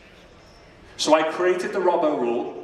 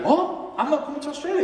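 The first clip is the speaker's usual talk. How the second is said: much higher, stunned "What?"; shocked disbelief; 11 semitones higher, faster than usual. Retold or imitated. Boy told he is left off the team, shocked. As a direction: squeak it out in disbelief. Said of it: "I was like".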